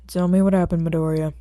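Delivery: detective demanding an account; stern interrogation. Acted. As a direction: press the kid for the story.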